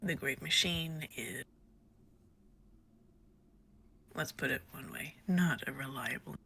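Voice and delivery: low, soft character voice; lightly voiced, quiet and low; Chifley's voice. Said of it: "when I voice Chifley".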